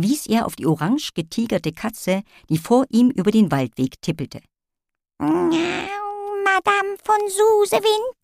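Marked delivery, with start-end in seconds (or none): 5.2-8.2 high meowing cat lady; little cat voice. Acted. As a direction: meow it like a tiny cat. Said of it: "miaute die kleine Katzendame".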